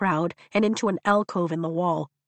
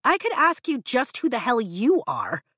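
defiant higher snap back; retorting; 5 semitones higher than usual. A narrator's reading. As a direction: throw it right back at him. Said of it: "she retorts".

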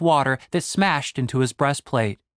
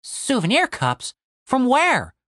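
high, questioning repeat; puzzled echo; 9 semitones higher, slower than usual. Acted. Tim queries Julian's odd inventory, puzzled. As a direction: echo it slowly in a raised pitch.

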